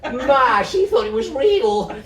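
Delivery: high, loud teasing through laughter; laughing jeers. A narrator's reading. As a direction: crow with glee at the trick. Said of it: "how they all laughed".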